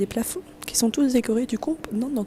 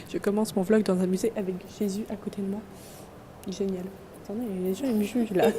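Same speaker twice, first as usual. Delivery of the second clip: quiet; 4 semitones lower, 5 dB quieter than usual. Real, not acted. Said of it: "Je peux pas parler fort".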